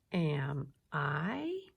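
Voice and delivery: "Am I" read word by word, "I" gliding up; rising question. Shown as a demonstration. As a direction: begin low on "Am" and raise "I". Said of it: "my voice goes up a little bit".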